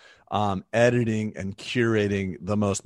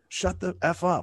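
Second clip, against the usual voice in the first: retold snap; 9 semitones higher than usual.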